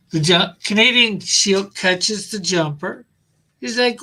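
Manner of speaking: ordinary delivery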